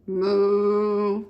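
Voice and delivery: long, low, level moo; cow's moo. A narrator's reading. Reader voices the cow for a child, long low moo. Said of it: "Cow said".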